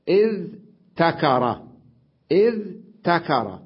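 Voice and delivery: إذ said high and loud, split cleanly from تكر; slow spelled-out original form. Shown as a demonstration. Teacher showing students the original form, syllables split. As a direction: stress إذ, pause, then تكر. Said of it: "أصلها إذ".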